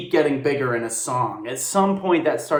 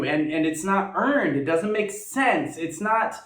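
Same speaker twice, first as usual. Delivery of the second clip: ordinary delivery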